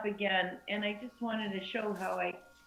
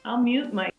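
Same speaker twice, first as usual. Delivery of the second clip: usual speaking voice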